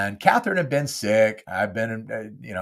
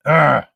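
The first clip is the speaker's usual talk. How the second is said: frustrated groan; 5 semitones higher, 9 dB louder than usual. Real, not acted. Sighing over setbacks.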